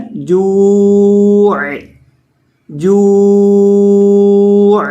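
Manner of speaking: جوع slow, ending on a clear throaty عین; clear ain at end. Shown as a demonstration. Teacher modelling the final ain for students. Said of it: "عین کو چھوڑنا نہیں ہے".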